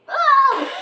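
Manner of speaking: short high loud yelp; high scream. Pretend. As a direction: yelp as if startled. Scream as he leaves.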